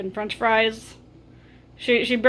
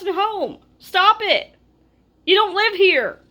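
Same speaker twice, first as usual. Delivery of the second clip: higher, sharper retelling of a scolding at the guest; retold scolding; 6 semitones higher than usual. Telling off the houseguest who ate all the groceries, exasperated. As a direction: scold him as if he emptied your fridge.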